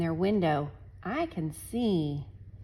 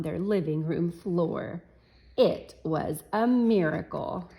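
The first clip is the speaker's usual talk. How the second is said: story reading. A narrator's reading. Reads picture book to class.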